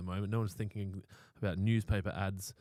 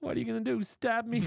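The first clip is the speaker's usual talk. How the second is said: high mocking voice; 3 dB louder than usual.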